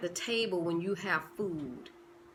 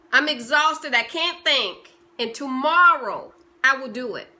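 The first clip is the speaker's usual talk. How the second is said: mock excuses; 4 semitones higher, 9 dB louder than usual. You promising tomorrow.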